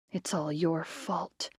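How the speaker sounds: soft and slow, bitter blame; accusing whisper. A narrator's reading. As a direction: hiss the blame quietly, slowly. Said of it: "Melanie whispered".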